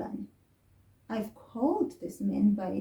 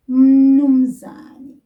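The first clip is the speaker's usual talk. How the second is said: "mnumzane" much louder, falling at the end; new word, loud; 4 semitones higher, 16 dB louder than usual. Shown as a demonstration. Teacher presents the new isiZulu word loudly. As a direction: call out "mnumzane" loudly for the class. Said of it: "word I would like you to learn".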